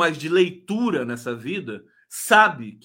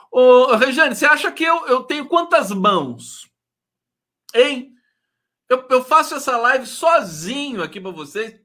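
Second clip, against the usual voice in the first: loud, higher exasperated protest; 7 semitones higher, 8 dB louder than usual. Real, not acted. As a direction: push back, fed up and loud. Host exasperated at chat request.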